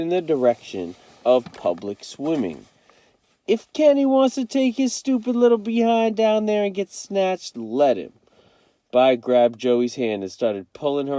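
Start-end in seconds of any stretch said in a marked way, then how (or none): 3.5-8.1 put-on high, scornful teen voice; sneering big brother. A narrator's reading. Byron scoffs at Kenny going to the beach, sneering. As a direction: brush him off with contempt.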